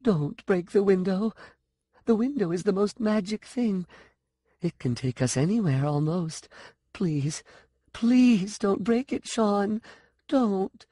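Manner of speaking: put-on high, small, begging child voice; frightened little-girl plea; little girl's voice. A narrator's reading. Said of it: "In a little girl's voice".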